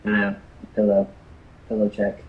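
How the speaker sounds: muffled mic check; muffled, as if through a pillow. Real, not acted. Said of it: "Still super muffled".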